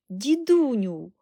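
loud, high, stretched-out call; excited call. A narrator's reading. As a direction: hail grandpa excitedly. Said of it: "гукнув Чарлі, пританцьовуючи від збудження".

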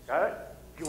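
ordinary delivery

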